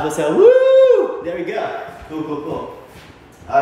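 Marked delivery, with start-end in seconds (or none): 0.4-1.2 a very high, loud whoop of joy; cheering woo. Real, not acted. Teacher cheers the class after their run: high, loud whoop.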